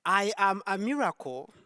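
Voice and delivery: ordinary talk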